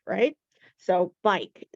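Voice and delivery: ordinary talk, nothing marked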